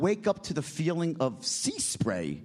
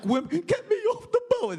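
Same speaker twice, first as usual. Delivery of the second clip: much higher, whiny mock plea through a laugh; seasick friend; 3 dB louder than usual. Seasick friend begging to get off the boat, high whiny mock plea. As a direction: whimper it like a seasick tough guy. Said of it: "is vomiting profusely from seasickness".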